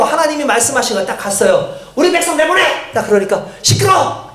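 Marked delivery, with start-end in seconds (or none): 1.9-2.9 high-pitched, forceful command; acted demand. Moses confronts Pharaoh to free his people, acted demand. 3.6-4.3 high, sudden dismissive outburst; acted angry rebuke. Pharaoh silences Moses' demand, acted angry rebuke.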